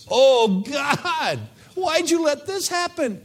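mock outraged plea, very high; whiny crisis prayer. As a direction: complain to God in a high wail.

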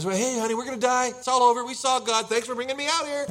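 high, loud, frantic husband; mock panic. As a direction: wail to your wife in comic panic. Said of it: "he was just panicked".

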